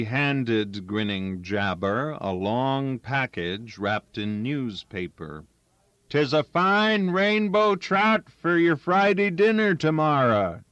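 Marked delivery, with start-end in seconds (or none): 6.1-10.6 much higher, loud call over gunfire; shouted. Acted. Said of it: "Danny shouted above the spat of the rifles".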